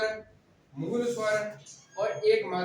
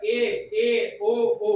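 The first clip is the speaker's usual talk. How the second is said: ए and ओ called out louder and drawn out slowly; loud vowel demo; 7 dB louder, much slower than usual. Shown as a demonstration. Teacher demonstrates to class which vowels are said loud. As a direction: belt out ए and ओ long and loud. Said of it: "ये तेज बोलेंगे".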